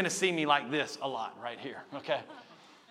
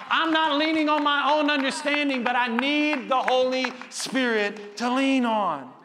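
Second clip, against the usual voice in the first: impassioned preacher, building higher and louder; full-force preaching; 8 semitones higher, 7 dB louder than usual. Real, not acted. Sermon builds to an impassioned climax.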